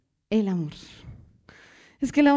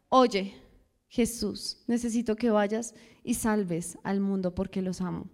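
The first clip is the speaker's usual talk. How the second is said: tender fatherly voice; 6 semitones lower, 7 dB quieter than usual. Acted. God speaking to his son.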